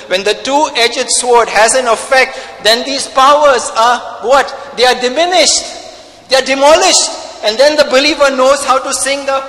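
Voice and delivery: loud, driving pulpit preaching; full-force preaching. Acted. Pastor thundering at his church, loud preaching. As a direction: hammer it home to the congregation.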